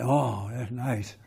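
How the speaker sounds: low, slow, soft satisfaction; satisfied nagger. Acted. Nagging partner once she gets you angry, quietly satisfied. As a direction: draw it out quietly, mock-satisfied. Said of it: "And then she's like".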